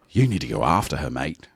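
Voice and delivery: low-pitched mock Aussie urging; imitating an insistent Australian; fake Australian accent. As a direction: urge a mate in a put-on Australian accent. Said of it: "talking to her in the Australian accent".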